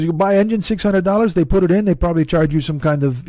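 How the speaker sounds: high-pitched imitation of a Swedish neighbor's accent; Oscar's accent. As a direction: put on the foreign accent, pitched well up. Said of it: "he talk like this".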